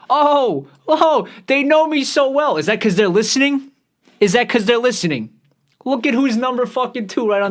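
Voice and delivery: high, loud burst of amazement; excited surprise. Real, not acted. Streamer reacting to who they suggest, high excited surprise. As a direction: exclaim it loud and high, astonished.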